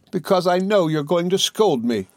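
high, plaintive exclamation; piteous voice. A narrator's reading. Dora afraid her husband will scold her, piteous. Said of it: "exclaimed Dora in a piteous voice".

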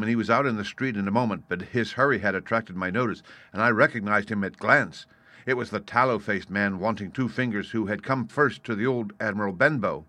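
usual speaking voice

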